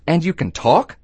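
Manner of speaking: high, incredulous question; amazed bartender. Acted. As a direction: ask it in rising disbelief. Bartender amazed that duck talks.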